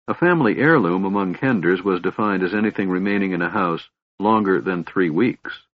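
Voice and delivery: ordinary delivery, nothing marked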